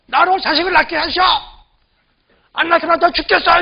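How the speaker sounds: high-pitched mock ultimatum from a wife; threatening plea. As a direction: act out a wife pressuring her husband. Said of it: "남편을 막 협박하는 거예요".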